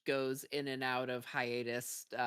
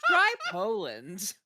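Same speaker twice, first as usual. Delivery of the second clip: ad voice; 5 semitones higher, 7 dB louder, slower than usual. Retold or imitated. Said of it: "this woman is like".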